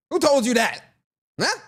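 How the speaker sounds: sudden high, loud mock-indignant demand; mock outrage. Streamer to chatter Nels over the baby rumor, mock outraged shout. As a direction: snap at the chatter in playful outrage.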